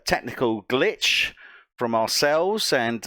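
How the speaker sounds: normal talking voice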